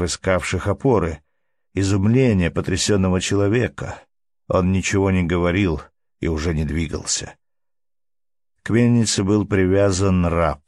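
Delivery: ordinary delivery, nothing marked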